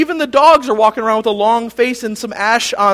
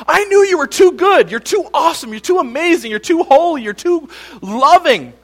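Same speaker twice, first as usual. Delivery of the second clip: higher, louder mock rant at God; hammed-up scolding, each too punched; mock scolding yell; 9 semitones higher, 6 dB louder than usual. Jonah scolding God for being too good, loud mock yell. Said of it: "something weird to yell at someone".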